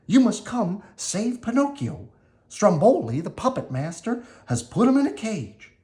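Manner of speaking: high, small cricket voice, urgent; urgent Jiminy. Acted. Jiminy telling Geppetto Pinocchio is caged, high and urgent. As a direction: beg for help in a high voice. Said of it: "he called".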